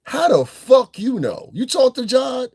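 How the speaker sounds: high, incredulous mocking questions; mocking disbelief. Real, not acted. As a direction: scoff at her claim in disbelief.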